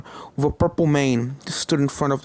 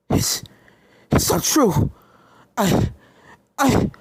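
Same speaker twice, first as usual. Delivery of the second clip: rising, stammering panic; panicked stammer; 6 semitones higher, slower than usual. Acted. Starlight panics in disbelief.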